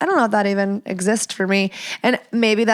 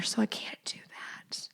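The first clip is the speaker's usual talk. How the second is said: unvoiced whispered aside; a hushed confession; 17 dB quieter than usual. Real, not acted. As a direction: drop to a whisper. Aside about being a mother.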